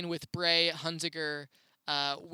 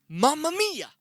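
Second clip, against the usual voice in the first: put-on high, loud kid's yell; sudden high shout of a child; 11 semitones higher, 8 dB louder than usual. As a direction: belt it out like an excited kid. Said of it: "this kid goes".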